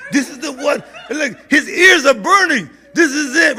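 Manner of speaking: amused and loud, laughing as he speaks. Real, not acted. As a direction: laugh out the words, delighted. Phone rings, caller is the friend.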